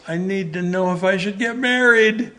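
high, loud, rushed blurt; nervous young man blurting his question. Retold or imitated. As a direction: blurt it out before you lose nerve. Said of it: "I kind of blurted out".

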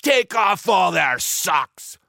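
high, slow battle order; drawn-out pirate command; cried out. A narrator's reading. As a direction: holler it to the whole crew. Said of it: "Joe cried out".

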